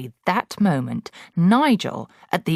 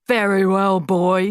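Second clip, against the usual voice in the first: put-on loud, higher, barking at a boy; bullying headmistress; 4 semitones higher, 6 dB louder than usual. Headmistress bullying Wilfred, loud and harsh. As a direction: loom over the child and bark.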